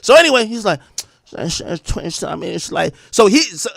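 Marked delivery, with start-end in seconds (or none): 1.3-2.9 slurred mumbling imitation, words running together; mush-mouthed. Mocking the rapper explaining himself, mush-mouthed. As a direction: garble it into soft mush. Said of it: "I can't repeat 'cause you won't get it".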